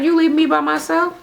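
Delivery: upset mother. Retold or imitated. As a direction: pitch way up like a wounded mom. Mother upset son leaving.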